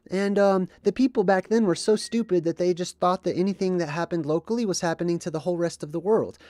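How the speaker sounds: high, loud mocking sneer; mocking skeptic voice.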